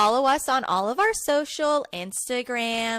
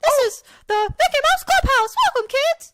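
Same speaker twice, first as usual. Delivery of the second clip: very high, loud squeaky Mickey; Mickey Mouse voice; 9 dB louder than usual. Acted. Cohost does Mickey Mouse for the game, squeaky high. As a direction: chirp it out like a cartoon mouse. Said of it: "I also put Mickey Mouse".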